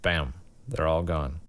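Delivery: normal talking voice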